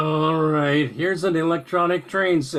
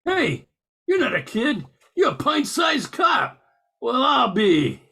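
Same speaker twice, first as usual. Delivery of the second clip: much higher, shocked outburst; surprised shout; 7 semitones higher than usual. Acted. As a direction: yelp in disbelief at being caught. Santa discovers kid is a cop.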